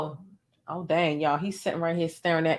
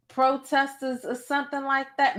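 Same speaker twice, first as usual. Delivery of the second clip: ordinary talk, nothing marked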